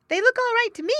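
very high, loud carefree otter; high young otter voice; Little Joe Otter. Acted. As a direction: toss it off lightly, sure of it. Little Joe's reply.

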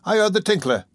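high-pitched, urgent report; alert cry. A narrator's reading. The Dodger warns of the bell, alert cry. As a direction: alert the gang, pitch lifted. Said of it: "cried the Dodger at this moment".

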